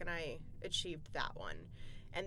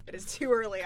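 laughing; 3 semitones higher, 8 dB louder than usual. Real, not acted. Laughing while greeting hosts.

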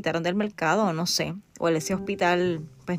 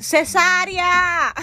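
drawn-out shout, higher and louder; mock shout; 9 semitones higher, 4 dB louder, much slower than usual. Mother retelling labour pain, hammed-up shout for a C-section. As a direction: belt the word out slowly like a plea. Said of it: "voy a tener que gritar".

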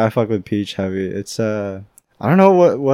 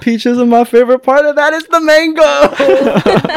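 much higher and louder through laughter; laughing; 14 semitones higher, 5 dB louder than usual. Real, not acted. Correcting himself about peach mango pie, laughing.

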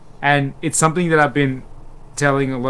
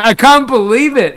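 higher and louder, mock astonished; amazed disbelief; 11 semitones higher, 11 dB louder than usual. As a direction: exclaim it in retold disbelief. Retelling his amazement at the sale.

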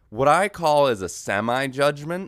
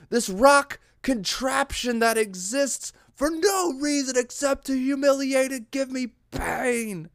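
hammed-up trapped victim; 11 semitones higher than usual. Hiker pinned under a boulder, mock agonised straining. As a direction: strain melodramatically under a crushing weight.

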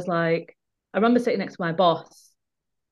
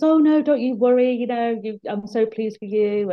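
voicing colleague; 4 semitones higher than usual. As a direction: reassure warmly, pitched up. Colleague voiced in retold call.

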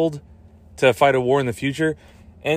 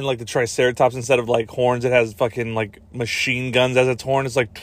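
ordinary talk

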